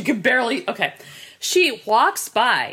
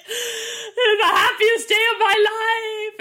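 Pee-wee Herman impression; 10 semitones higher, much slower than usual. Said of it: "He's like".

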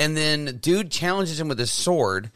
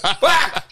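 high yell capping a rant; exasperated cry breaking into laughter; frustrated high outburst; 10 semitones higher, 4 dB louder than usual. Real, not acted. Peak of his flip-out rant.

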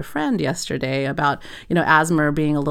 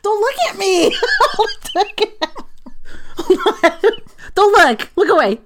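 high laughing plea; 5 dB louder than usual. Real, not acted. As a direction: giggle while begging them off. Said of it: "I'm not".

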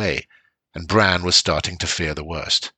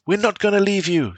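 put-on high child voice, insistent; child's voice; 13 semitones higher than usual. A narrator's reading. Son telling father they will help, high child voice. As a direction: speak up like a brave kid.

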